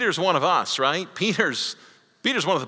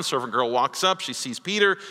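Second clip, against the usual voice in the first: usual speaking voice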